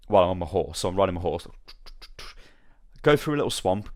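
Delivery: usual speaking voice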